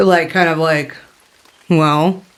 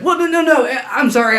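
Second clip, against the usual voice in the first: higher, stammered protest; flustered apology; 9 semitones higher, faster than usual. Acted. Talrin apologises to the woman in the cave, flustered. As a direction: backpedal with a quick run of no's.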